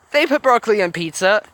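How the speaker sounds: animated, high-pitched remark; incredulous aside. Real, not acted. Reader's amused aside repeating the line.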